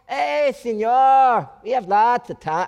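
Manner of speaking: put-on slow, loud, high Mexican accent; relaxed Mexican accent, taking time. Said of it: "I can't even do the accent".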